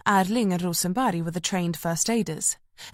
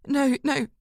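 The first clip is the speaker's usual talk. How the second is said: strained; 7 semitones higher than usual. A narrator's reading. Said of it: "Jamie squeezed out".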